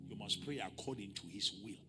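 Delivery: normal talking voice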